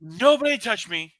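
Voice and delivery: hammed-up hands-off order; mock indignation. Mocking the friend warning ghosts off.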